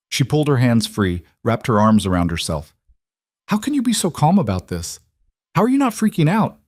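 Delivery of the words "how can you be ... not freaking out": high-pitched, agitated disbelief; incredulous, freaking out. Acted. Woman asks calm lover why he isn't panicking, incredulous. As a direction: let the pitch leap up in dismay.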